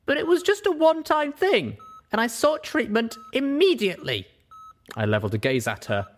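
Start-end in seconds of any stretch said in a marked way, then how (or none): 0.1-4.3 Pip defending herself. Acted.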